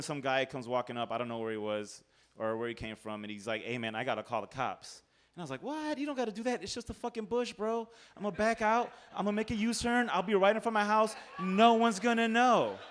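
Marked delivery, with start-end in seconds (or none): 3.6-5.0 low stranger voice. Retold or imitated. Stranger insists on calling cops. 5.6-12.8 high pleading. Retold or imitated. Drunk pleading with stranger.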